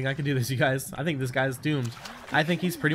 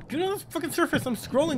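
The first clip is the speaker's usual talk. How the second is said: high frantic plea; desperate urging; 12 semitones higher than usual. Real, not acted. Gamer desperately begging his character to surface. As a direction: beg him to surface, climbing high.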